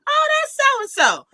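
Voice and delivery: high-pitched, gentle old relative impression; sweet great-grandmother. Granddaughter imitating her great-grandmother answering who someone is, sweetly. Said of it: "who's the nice, sweet one".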